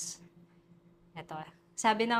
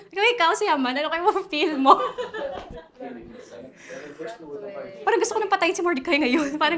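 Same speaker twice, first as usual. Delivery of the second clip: high and loud, laughing through it; 7 semitones higher, 8 dB louder, much faster than usual. Real, not acted. Laughing while asking the class how Haman feels. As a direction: crack up picturing Haman's rage.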